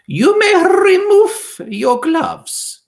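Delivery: very high, loud, slow witch command; harsh Grand High Witch command; witch's shout. A narrator's reading. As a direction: boom it out slowly. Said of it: "she shouted".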